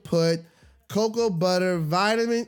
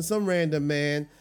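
ordinary delivery

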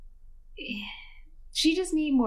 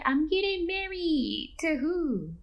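higher, slow, sing-song mother voice; giddy mom; 9 semitones higher, much slower than usual. Retold or imitated. Mother telling daughter she's marrying Steve, higher and giddy. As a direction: savor the news like a gift.

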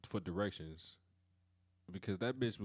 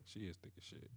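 whispering; 3 semitones lower, 15 dB quieter than usual. Real, not acted. Gossiping about a woman.

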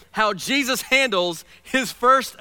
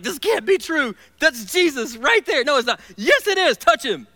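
excited back-and-forth, much higher and louder; giddy disbelief; 6 semitones higher, 4 dB louder than usual. Retold or imitated. Disciples arguing over seeing Jesus, giddy and excited. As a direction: bounce between no and yes like giddy friends. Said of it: "They were, they were giddy".

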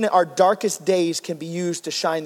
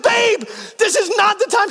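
much higher, urgent and strained; frantic exasperation; 13 semitones higher than usual. Retold or imitated. Husband to wife during the emergency, frantic. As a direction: plead in panic.

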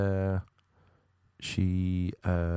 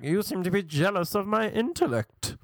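high, swooping mock-posh voice; theatrical English-sounding man; 9 semitones higher than usual. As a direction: protest grandly in an affected English put-on.

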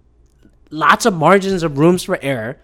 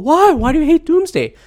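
higher, mocking voice of a puzzled fan; mock fan question; 11 semitones higher, 3 dB louder than usual. Podcaster imitates people asking why he hates Doomsday, in a higher mocking voice. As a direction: toss the question up high like a baffled fan. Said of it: "Some people go".

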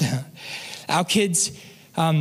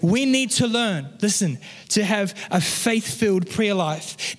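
ordinary talk, nothing marked

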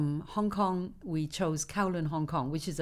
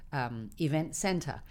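usual speaking voice

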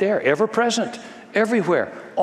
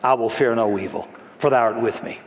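lower, hushed recited psalm; reverent prayer; 4 semitones lower than usual. Acted. David prays the psalm, lower and reverent. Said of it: "David prayed".

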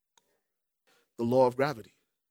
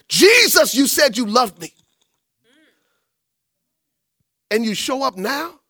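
Martha confronting Jesus; 6 semitones higher, 5 dB louder than usual. Acted. Preacher voices grieving Martha.

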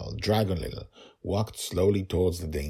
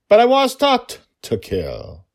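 emphatic; 12 dB louder than usual. Real, not acted. Emotional confession.

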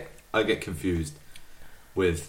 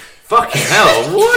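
loud, slow stunned exclamation; amazed; 10 semitones higher, 10 dB louder, much slower than usual. Real, not acted. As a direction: react in disbelief. Amazed at friend's rap.

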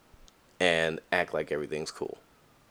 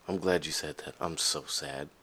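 mock sad confession; 6 dB quieter than usual. Voicing an honest sad reply.

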